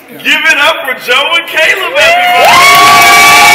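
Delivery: very high, long, loud call to the crowd; excited shout. Real, not acted. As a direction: holler it out like a game show host. MC calling applause for couple.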